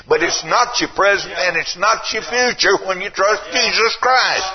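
high, loud, impassioned preaching; full-force revival sermon. Acted. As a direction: lift the room with it.

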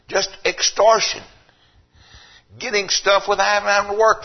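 high, loud, rising preacher fervor; full-force Sunday sermon voice; preaching. Acted. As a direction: preach it hard to the pews. Preaching to the congregation.

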